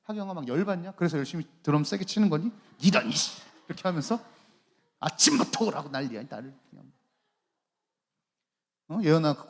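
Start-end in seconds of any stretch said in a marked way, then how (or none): 2.8-3.4 short, hammed-up curse; mock annoyance. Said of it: "막 짜증나냐?". 5.0-6.9 put-on cross complaint; mock annoyance. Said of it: "막 짜증나냐?".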